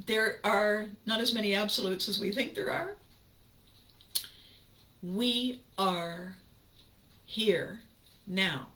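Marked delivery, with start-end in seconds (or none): none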